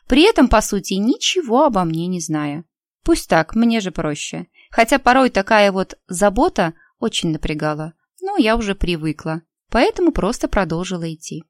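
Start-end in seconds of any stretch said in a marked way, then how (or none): none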